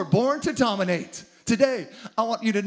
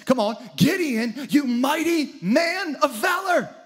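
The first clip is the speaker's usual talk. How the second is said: higher, slow booming call from above; booming God voice; 6 semitones higher than usual. Acted. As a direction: proclaim like God rallying a hero. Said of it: "God comes to him and says".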